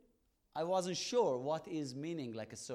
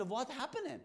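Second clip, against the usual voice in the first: excited; 9 semitones higher than usual. Retold or imitated. Crowd surprised in café.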